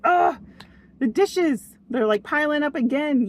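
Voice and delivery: imitating her old fed-up self; irritated. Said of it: "I used to get so irritated".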